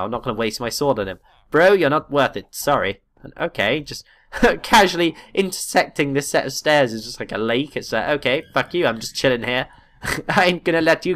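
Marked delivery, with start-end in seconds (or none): none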